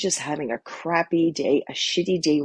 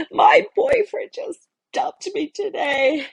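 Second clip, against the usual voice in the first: higher, slow, sobbing-through-words imitation of a dumped teen; imitating crying girl; 14 semitones higher, 4 dB louder, slower than usual. Dumped teen tells her coach through tears, mock sobbing. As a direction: act out a girl crying her heart out, words pulled long. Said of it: "And she's crying".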